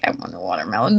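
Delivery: very low, soft gremlin croak; little gremlin. Retold or imitated. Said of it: "Like a little gremlin".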